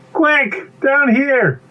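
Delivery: high, hurried warning; urgent escape call. Acted. Bee directs pea to hiding place, urgent. Fleeing the bird.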